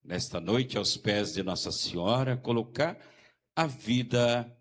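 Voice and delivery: normal talking voice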